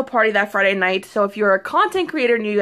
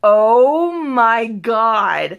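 slow, drawn-out and louder in awe; amazed; 4 dB louder, much slower than usual. Retold or imitated. Voicing her friend reading the email, slow stunned awe. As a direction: stretch it out, stunned by the news. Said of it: "he was like".

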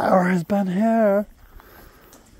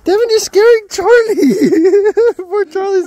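laughing high; 10 semitones higher, 5 dB louder than usual. Real, not acted. Laughing at scared dog.

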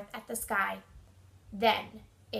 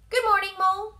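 high, loud, cheery greeting; Mouse; 7 semitones higher, 6 dB louder, much faster than usual. A narrator's reading. Mouse greeting Mole at the door, high and loud. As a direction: sing out a high-pitched hello. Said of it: "said Mouse".

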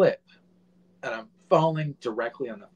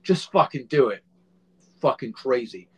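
pushy producer barking orders; impatient boss, just get it done; mimicked producer; 8 dB louder than usual. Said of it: "producer, director".

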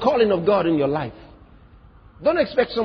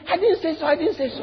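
higher mock-evasive denial; 8 semitones higher than usual. A hint of mocking a timid Jesus denying. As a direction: play Jesus dodging it, high. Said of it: "He didn't talk like that".

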